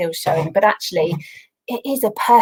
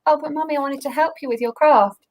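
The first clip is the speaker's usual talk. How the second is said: put-on a higher, childlike pleading voice, lightly done; imitating her little girl; 7 semitones higher than usual. Daughter Lara begging her mum to help with the craft, lightly childlike. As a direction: mimic a disappointed small daughter asking to help. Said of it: "She was like".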